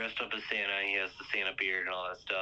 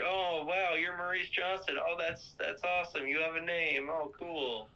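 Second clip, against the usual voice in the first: higher-pitched wowed reaction; impressed; 7 semitones higher than usual. Retold or imitated. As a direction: imitate someone pleasantly amazed.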